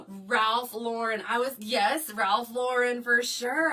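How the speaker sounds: excited agreement. Real, not acted. Agreeing eagerly with a viewer's suggestion. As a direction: savor the brand name with delight.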